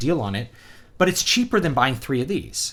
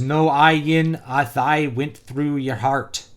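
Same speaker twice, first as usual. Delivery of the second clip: slow, higher, deliberate Irish reading; Irish accent; 4 semitones higher, 5 dB louder, much slower than usual. Shown as a demonstration. Reviewer reading comic dialogue out loud, in a laboured Irish accent. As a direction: sound it out stiffly in an Irish accent. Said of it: "could probably just do an Irish accent".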